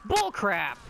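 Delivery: loud, high "bullcrap" protest; indignant outburst. Real, not acted. Gamer fuming at the game, loud protest. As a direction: blurt it out in disgust at the game.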